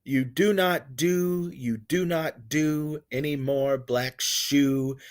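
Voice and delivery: slow, deliberate recitation; measured poetic delivery; poem reading. Shown as a demonstration. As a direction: read the line slowly, leaning on do. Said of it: "What about".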